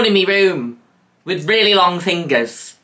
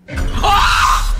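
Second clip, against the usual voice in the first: masculine scream; 4 dB louder than usual. Real, not acted. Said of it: "this masculine voice protrudes out of my mouth".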